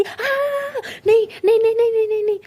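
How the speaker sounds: high, loud panicked screaming; terrified scream. Acted. Anokhi shrieks in fright at Rose's ghost prank. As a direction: scream in pure terror. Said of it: "ज़ोर से चिल्लाती".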